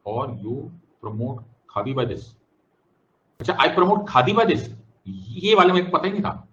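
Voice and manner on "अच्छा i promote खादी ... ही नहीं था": higher, louder mock amazement; mock surprise. Teacher mock-amazed at a student's song theory, higher and louder. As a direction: ham up being amazed.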